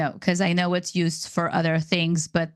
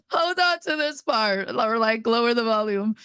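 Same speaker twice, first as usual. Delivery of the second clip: joking warning; 3 semitones higher than usual. Pretend. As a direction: lift the voice into a kidding warning. Joking before reading hard names.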